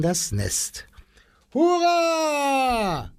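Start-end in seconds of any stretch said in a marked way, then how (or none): 1.5-3.1 very high joyful hurrah; cheering. Acted. The people cheering as the young titmice fly out. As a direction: cheer like a happy crowd. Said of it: "schrien die Leute".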